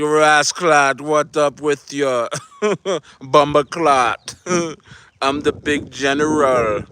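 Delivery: a mock Jamaican accent with slow, stretched laughing phrases; imitating a fake Jamaican persona, half laughing. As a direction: lightly ham up a Jamaican patois persona. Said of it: "He took on a Jamaican persona".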